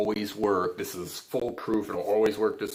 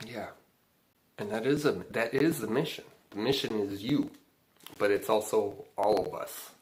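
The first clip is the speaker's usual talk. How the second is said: ordinary delivery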